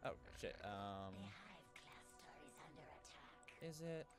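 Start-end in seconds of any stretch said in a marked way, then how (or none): none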